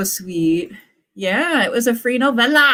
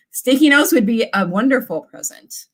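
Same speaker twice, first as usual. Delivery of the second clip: ordinary talk, nothing marked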